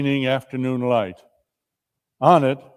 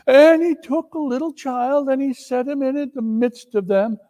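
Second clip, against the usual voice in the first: put-on higher boyish reading; boy reading aloud; 13 semitones higher, 3 dB louder than usual. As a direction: read the verse like a child. Peter reads aloud.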